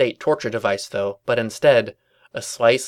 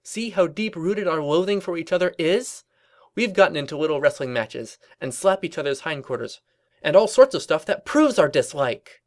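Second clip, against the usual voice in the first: high, insistent cartoon voice; triumphant Twilight; 7 semitones higher than usual. Twilight proving their loathing to Pinkie, high triumphant insistence. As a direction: press the point eagerly, pitched up.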